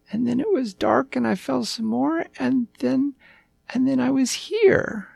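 put-on high, childlike storytelling voice; earnest little puppy. A narrator's reading. Puppy recounting his adventure to the king, high and earnest. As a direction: ramble through it like a small high-voiced pup.

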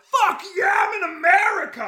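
high, loud whoop of joy; joyful shout. Retold or imitated. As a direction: yell it out, overjoyed. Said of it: "I'd wake up and be like".

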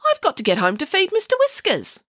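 put-on high-pitched fretful old lady. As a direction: fuss like an old lady wanting home. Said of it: "said an old lady".

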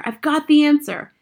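loud, high cry of discovery; excited shout. A narrator's reading. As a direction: burst out with the news. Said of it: "I shouted".

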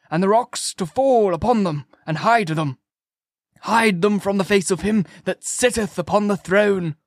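high, ringing scriptural declamation; doom-preaching curate. A narrator's reading. Curate prophesies the end to narrator, fervent preaching. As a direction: chant out the Day of Judgement.

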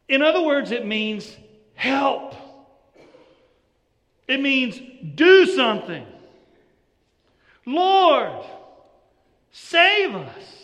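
loud, high pleading cry; preaching at full force. Acted. Preacher urges his congregation, a loud high plea. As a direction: cry out to God for rescue.

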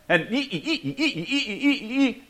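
quiet mock bickering squeaks; mocking squabble noise. Spouses trading jabs, soft mocking squeaks. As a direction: squeak out the endless back-and-forth.

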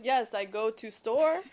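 slow, mock foreign-accented English; imitated broken English. Woman imitates herself in Brazil, slow mock foreign accent. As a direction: spell it out slowly in a put-on foreign accent. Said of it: "speaking English worse because I was, like, mirroring".

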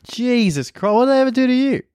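high, incredulous exclamation; mock shock. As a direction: rise into exaggerated disbelief. Exclaiming at nonna's racism.

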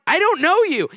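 high-pitched retold disbelief; baffled protest. Boy to a stranger claiming to be his girlfriend, baffled.

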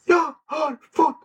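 high, slow, tearful mock faint; man at the car dealer, faint from shock, whimpers high; near fainting. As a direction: gasp it out high and drawn-out, about to faint. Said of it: "Han höll på att svimma".

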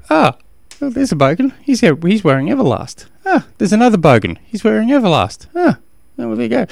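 a hammed-up thrill at every sighting; mock delight. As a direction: point out each one like a game. Mock spotting bogans everywhere.

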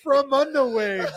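slow, laughing through the tagline; laughing slogan. Real, not acted. Host fighting laughter on the slogan, slow and high. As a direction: try to say it while cracking up.

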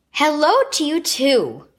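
slow, huffy sarcastic greeting. A narrator's reading. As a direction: huff it out, miffed. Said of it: "Lindsay huffed".

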